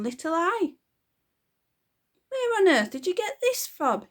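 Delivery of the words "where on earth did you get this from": high, loud spluttering outrage; flustered father splutters. Acted. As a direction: choke out the question in shock. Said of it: "he spluttered".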